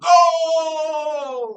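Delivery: high, loud, long-held announcer yell; mock goal call. Mocking soccer commentary, drawn-out goal shout. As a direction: stretch the word like a soccer announcer.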